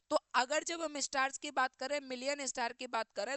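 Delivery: ordinary talk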